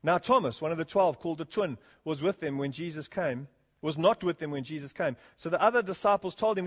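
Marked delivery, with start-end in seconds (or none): none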